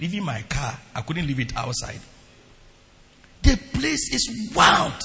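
ordinary talk, nothing marked

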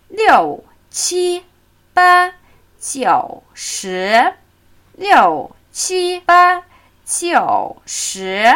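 "Liu" falls, "qi, ba" stay high, "jiu" dips, "shi" rises; falling, high, dipping, rising. Shown as a demonstration. Teacher drilling the full tone pattern, falling, high, dipping, rising. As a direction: drop "Liu", hold "qi, ba" high, squat on "jiu", lift "shi". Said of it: "Wir fallen, wir fliegen hoch".

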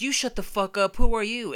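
mock yell, indignant comeback; retold yelling back. Man snapping back at a hidden heckler, yelling. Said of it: "we're yelling back at him".

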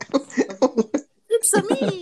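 high, loud cartoon hero voice; silly character voice. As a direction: pop out a goofy character line. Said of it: "my little impersonation of the day".

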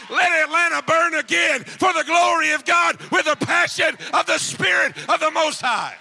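building sermon climax. Acted. Pastor calling fire on the city, shouting preacher. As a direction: drive the crowd toward a roaring finish.

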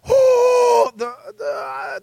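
high-pitched whoop, then faltering stammer; hammed-up jittery hesitation; nervous stalling. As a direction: act out losing your nerve at a high pitch. Acts nervous before delivering word.

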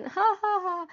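high, sarcastic "ha, ha, ha" laugh; sarcastic fake laugh. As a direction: laugh mockingly, clearly not amused. (rire faux et sarcastique)

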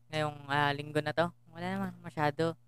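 low-pitched, soft, barely lifting; low and quiet. Real, not acted. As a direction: let the line stay down, softly. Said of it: "hindi na tumataas yung linya".

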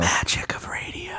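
soft unvoiced whisper; slow mock-mysterious whisper. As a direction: breathe it like a secret aside. Joking hushed aside.

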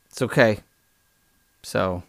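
ordinary talk

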